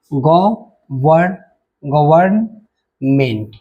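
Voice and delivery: Government drawn out very slowly in parts; teacher slowly breaking up government; syllable by syllable. Shown as a demonstration. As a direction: sound out government with gaps between syllables. Teacher sounds out word's syllables.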